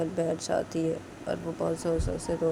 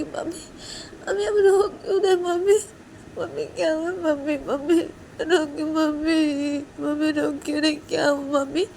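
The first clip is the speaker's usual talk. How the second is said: put-on high child voice, tearful and pleading; a small child asking why mummy cries; high-pitched worried kid; worried little girl; 11 semitones higher, 3 dB louder than usual. Friends ask crying mother.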